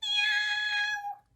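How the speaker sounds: very high, loud drawn-out meow; piercing, stretched cat's meow. Acted. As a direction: meow like a cat greeting someone, high and loud. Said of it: "she said".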